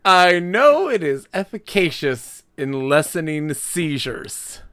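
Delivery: slow, high, put-on grandiose voice; mock pompous echo. Co-host parroting the other's fancy wording, mock pompous. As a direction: parrot it back in a hammed-up voice. Mocking echo of co-host's careful phrasing.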